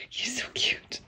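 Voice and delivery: soft adoring whisper. Real, not acted. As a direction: whisper it fondly.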